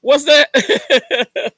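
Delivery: high-pitched, loud question breaking into laughter; laughing. Real, not acted. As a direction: crack up as you ask it. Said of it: "I'm sorry for laughing".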